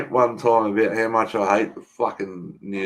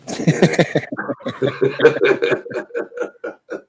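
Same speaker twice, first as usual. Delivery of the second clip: high, wheezing helpless laugh; long laugh; 6 semitones higher than usual. Real, not acted. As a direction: crack up at length, mostly breath. Cracks up after his own remark.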